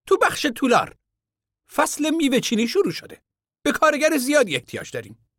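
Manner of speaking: a high voice for the stranger; high contractor voice. A narrator's reading. Contractor asking the camp men about work, high voice.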